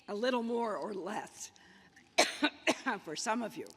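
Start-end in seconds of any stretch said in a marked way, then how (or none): 2.2-3.0 harsh chesty cough. Real, not acted. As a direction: cough mid-speech. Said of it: "I've gotta get a lozenge".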